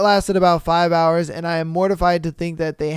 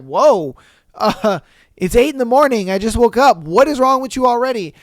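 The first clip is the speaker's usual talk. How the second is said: higher and louder, baffled and defensive; bewildered mock protest; 5 semitones higher, 5 dB louder than usual. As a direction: play the confused boyfriend caught off guard, voice up. Bewildered partner defends himself.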